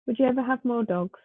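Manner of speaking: ordinary talk, nothing marked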